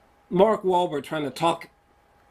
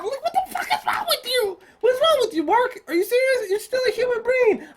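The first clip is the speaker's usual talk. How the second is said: much higher exasperated disbelief; mock-shrill scolding of the film's hero; incredulous rant. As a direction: scold the hero for being dumb.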